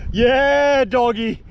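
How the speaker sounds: loud, high whoop of victory; triumphant yell. Real, not acted. Crabber celebrating his catch, loud triumphant whoop. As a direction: shout it as the crab comes free. Crab finally pulled out.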